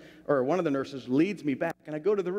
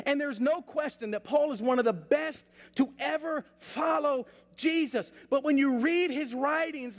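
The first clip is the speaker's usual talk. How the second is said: higher-pitched, emphatic pulpit preaching; urgent, raised sermon delivery; 9 semitones higher than usual. Real, not acted. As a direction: drive the point home to a congregation. Preaches the lesson after story.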